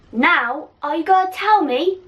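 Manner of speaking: high, loud demanding yell; hammed-up witch shout; villain yell. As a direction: demand it in his face. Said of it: "Bellatrix yelled in Ron's face".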